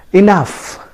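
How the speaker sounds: "enough" said ih-NUF; pronunciation demo. Shown as a demonstration. Teacher modelling for learners, "enough" as ih-NUF. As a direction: say "enough" with a final f sound. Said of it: "pronounced as".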